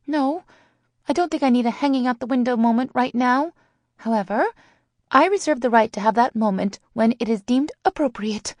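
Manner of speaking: put-on high, brisk young friend voice; high-voiced reply to a teasing friend; Sally's voice. A narrator's reading. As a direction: pitch it well up, measured and insistent. Sally's high character voice, breathing slowly.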